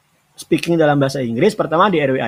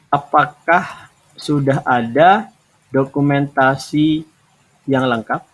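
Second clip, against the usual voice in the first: slow halting dictation; much slower than usual. Shown as a demonstration. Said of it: "Kamu jawab seperti ini".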